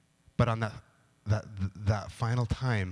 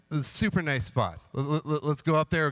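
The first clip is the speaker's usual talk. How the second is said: ordinary talk, nothing marked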